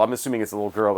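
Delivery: ordinary talk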